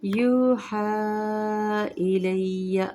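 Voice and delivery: slow melodic tajweed chant; Quran recitation. Shown as a demonstration. Recites verse.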